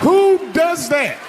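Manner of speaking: high, slow, indignant shout; high slow outraged yell at the crowd. Real, not acted. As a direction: bellow it in disbelief. Outrage at wife opening box.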